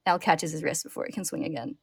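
usual speaking voice

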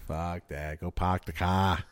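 dismissive local, lightly put on; Boston accent. Host joking in a Boston accent.

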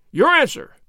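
sharp loud demand for an answer. A narrator's reading. Nobleman to governor, a loud insistent demand. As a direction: bark it out, insisting. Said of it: "Don Alejandro demanded".

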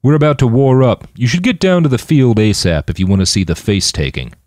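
loud rallying announcement; calling out. A narrator's reading. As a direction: call it out across the deck. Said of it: "he called out".

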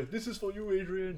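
Rocky destroying the Star Destroyer, softly tender; mock tender dedication. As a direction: dedicate it gently to Adrian.

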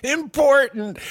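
high mock emphasis on IMPORTANT; mocking echo. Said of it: "the way you said important".

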